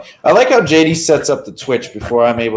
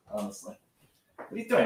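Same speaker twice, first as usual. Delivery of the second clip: usual speaking voice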